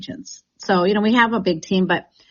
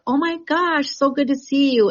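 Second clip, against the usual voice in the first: imagined gushing hello, pitched high; delighted greeting; 7 semitones higher than usual. Pretend. Agent greeting clients in the grocery store, gushing and delighted. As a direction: greet them like you're thrilled to bump into them.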